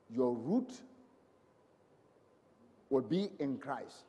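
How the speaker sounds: low, soft pronouncement with long pauses; hushed, deep preacher's benediction; solemn blessing. Real, not acted. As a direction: pronounce it gravely, phrase by phrase. Pronounces a blessing over congregation.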